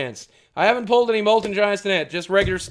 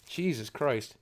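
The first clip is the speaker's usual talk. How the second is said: ordinary talk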